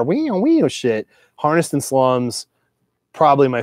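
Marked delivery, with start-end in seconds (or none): 0.0-1.1 high, slow wailing wee-oo; guitar squeal imitation. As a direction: mimic a twangy guitar bend. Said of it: "you know, guitar".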